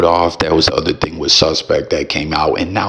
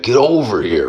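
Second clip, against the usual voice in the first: lightly voiced, pitched-up Scorpion call; 6 semitones higher than usual. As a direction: call it out like the game ninja. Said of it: "Scorpion voice".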